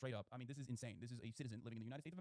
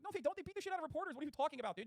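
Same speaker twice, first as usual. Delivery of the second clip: high, loud exasperated disbelief; incredulous rebuttal; 12 semitones higher, 4 dB louder than usual. Real, not acted. Streamer scoffing at chat's claim about cops, exasperated high shout. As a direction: fire back in disbelief.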